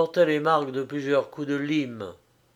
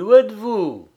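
higher, louder searching question; calling out; 7 semitones higher, 8 dB louder than usual. A narrator's reading. Frédéric gets no sight of Bernerette, calling out. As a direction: call through the house for her.

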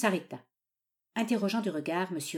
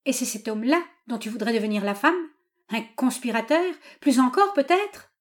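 rising, louder indignant questions; incredulous father's challenge; scornful old father; 6 semitones higher, 6 dB louder than usual. A narrator's reading. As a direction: fling accusations at her, climbing in pitch.

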